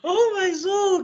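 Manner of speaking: high, drawn-out oh my soul; a high, mock-scandalised oh my soul; amused exclamation. Real, not acted. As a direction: gasp it out in amused disbelief.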